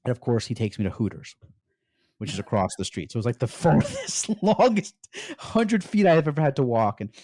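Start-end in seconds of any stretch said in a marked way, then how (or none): 3.4-7.0 laughing. Real, not acted. Recalling the nerve-racking walk.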